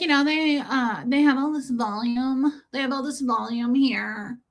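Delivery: gently mocking the bra fitter; imitating the shop girl. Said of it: "She sounded like that".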